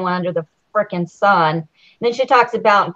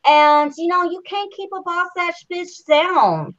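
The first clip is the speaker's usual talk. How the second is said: mimicking her accent, pitched up; higher-pitched mock accent of a housemate; Rockstar; 5 semitones higher than usual. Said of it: "it's the accent, y'all".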